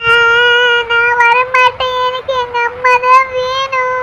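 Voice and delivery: put-on high, slow toddler wail, stubbornly refusing; crying child. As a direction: drag the words out in a tearful whine. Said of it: "ஏன்டா இப்படி அழுவுற". (அடம் பிடித்து அழும் குழந்தை)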